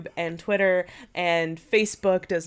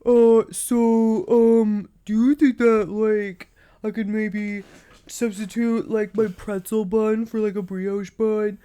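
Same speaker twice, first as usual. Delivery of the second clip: slow, dopey put-on voice; dumb voice; 4 dB louder, slower than usual. Shown as a demonstration. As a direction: drag the words out like a dim-witted customer. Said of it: "recreate my dumb voice".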